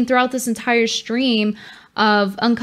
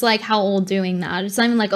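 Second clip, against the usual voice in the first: usual speaking voice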